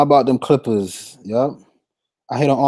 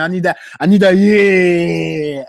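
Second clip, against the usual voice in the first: higher, louder demo of fired-up attitude; hyped player attitude; 5 semitones higher, 5 dB louder, slower than usual. Shown as a demonstration. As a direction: build into a long, loud roar. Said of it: "attitude out of my players".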